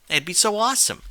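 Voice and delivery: excited. A narrator's reading. Rainbow Dash excited daydream.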